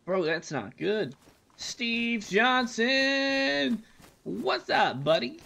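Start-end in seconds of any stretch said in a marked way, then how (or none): 1.6-5.4 slow, high drawn-out call of the name. Real, not acted. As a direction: stretch the name out like an announcer. Greeting a viewer.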